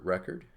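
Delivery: "record" with the first syllable stressed, as a noun. Shown as a demonstration. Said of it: "So we put the accent here".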